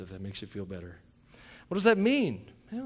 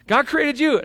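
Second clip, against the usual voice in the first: higher and louder, building fervor; full-force preaching; 9 semitones higher, 8 dB louder than usual. Acted. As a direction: shout the good news to the church.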